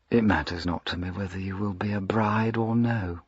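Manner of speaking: cold whisper close to her ear; whispered menace. Acted. Duke whispers his indifference to Diana's fate. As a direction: breathe the threat softly. Villain threatens, voice dropped.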